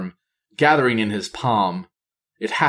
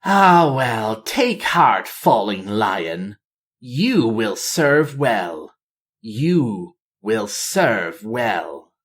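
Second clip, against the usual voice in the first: gloating villain; much slower than usual. A narrator's reading. Villain gloating over captive king.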